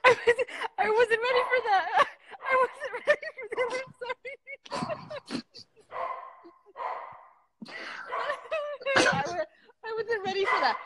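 breathless giggly high voice; after laughing hard. Real, not acted. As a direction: still laughing, can't get words out. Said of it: "That was too much for me".

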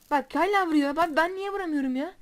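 loud, exasperated complaint at the game; frustrated outburst. Real, not acted. Gamer yelling at the game in frustration, loud. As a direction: yell your disbelief at the screen.

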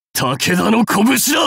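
high, forceful battle shout; rising warrior yell; attack cry. Acted. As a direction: shout it as the blow lands. Attack call.

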